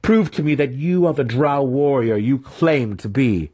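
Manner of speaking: high, intense challenge; goading dare. A narrator's reading. Mentor demanding his student prove himself, goading.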